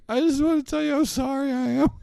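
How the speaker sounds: put-on high, meek little-boy apology; exaggerated childish sorry voice; mock sorry kid. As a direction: squeak out a fake apology. Imitating own childhood apology.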